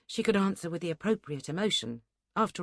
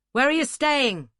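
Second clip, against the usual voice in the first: louder and higher, calling over street noise; raised voice; 8 semitones higher, 8 dB louder than usual. A narrator's reading. Duncan to the girl behind him, over squealing pigs, loud raised voice. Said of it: "raising his voice to be heard".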